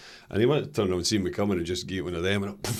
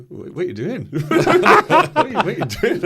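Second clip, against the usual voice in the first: higher, laughing mock disbelief; laughing quote; 9 dB louder than usual. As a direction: imitate a bewildered teammate while laughing. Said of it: "Simon Ferry's like".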